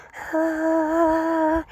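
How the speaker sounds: high airy hum imitating a car; airy hybrid car sound. Vlogger imitating a hybrid car's airy sound, high and breathy. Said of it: "this airy sound that's just like".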